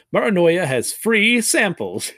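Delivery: drawn out and high with delight; greedy glee. Acted. God gloating to Nabutaku about free samples, slow greedy glee. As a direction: savor the words like a glutton eyeing food.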